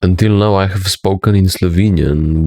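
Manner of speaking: usual speaking voice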